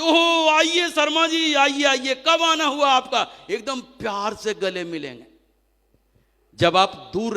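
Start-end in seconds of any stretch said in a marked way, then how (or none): 0.0-3.5 high, slow, sugary greeting; mock warm welcome. Welcoming a returning colleague at the venue, mock-affectionate. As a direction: gush over an old rival like a dear friend. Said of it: "एकदम बड़े प्यार से".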